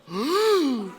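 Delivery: long, high scandalised "oh"; crowd's shocked gasp. Retold or imitated. Two thousand people reacting to the outburst, shocked gasp. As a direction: gasp like a whole shocked audience. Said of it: "about two thousand people go".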